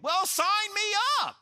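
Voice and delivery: high, drawn-out mock eagerness; sarcastic enthusiasm. Would-be convert accepting beatings and death, mock-eager high cry. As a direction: feign delight at a terrible offer, slow and high. Mock recruit joining persecuted religion.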